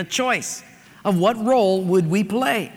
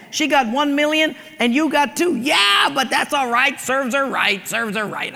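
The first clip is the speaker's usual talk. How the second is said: gloating shout; 6 semitones higher than usual. Acted. Husband shouts his gloating at the genie, high.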